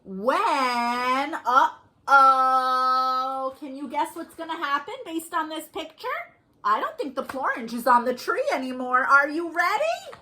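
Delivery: put-on lively, sing-song read-aloud for little kids; storytime reading. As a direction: read it like a picture book to small children. Reading picture book to kids.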